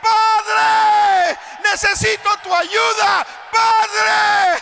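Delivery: very high, loud drawn-out cry. Acted. Preacher voicing a sinner crying to God, shouting. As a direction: plead to the Father at full voice. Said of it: "empieza a decir".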